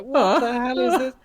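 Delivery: loud, high cry of outrage; retold tearful outburst. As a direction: act out a child's upset wail, loud and high. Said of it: "I was just started crying".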